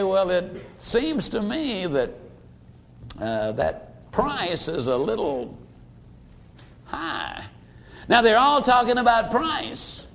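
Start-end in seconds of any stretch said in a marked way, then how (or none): 0.0-7.4 softer, slow and hesitant, trailing off; shy buyer barely daring to object, fading out; timid and hesitant. Retold or imitated. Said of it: "a little timid or a little hesitant".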